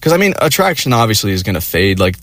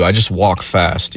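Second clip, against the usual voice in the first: ordinary delivery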